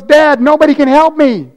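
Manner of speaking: high, loud mock despair; hammed-up hurt child. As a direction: cry out to dad as a dramatic kid. Acted hurt child despairing to father.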